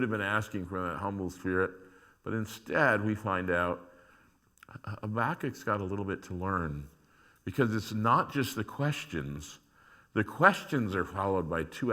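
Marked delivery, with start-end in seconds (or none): none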